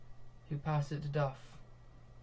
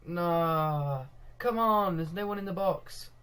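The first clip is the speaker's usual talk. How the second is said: exasperated protest; 3 semitones higher, 5 dB louder, slower than usual. Real, not acted. Commentator to own team with nobody in the box, exasperated. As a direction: cry out at a wasted chance. Frustrated fan at missed chance.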